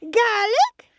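high, loud questioning sheep voice; hopeful sheep. Acted. Sheep thinks her husband is at the door, hopeful call. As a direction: call the name upward, high.

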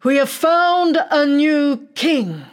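high, loud and slow announcement; king's proclamation. Acted. King announces the new king, loud and slow. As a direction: proclaim it grandly to the hall. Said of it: "hears the king say".

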